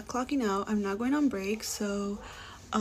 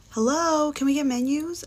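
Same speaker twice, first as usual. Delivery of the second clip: shouted, raised-pitch hello across the room; loud call for menus; 5 semitones higher, 5 dB louder than usual. Retold or imitated. Rude customer calling loudly across the restaurant, loud raised hail. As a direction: call out loud to get the server's attention.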